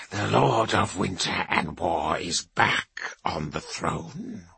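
low, slow, grim warrior priest; stern Rune Priest. Acted. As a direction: speak low and heavy, with authority. Kavar's low held voice.

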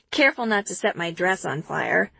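ordinary delivery

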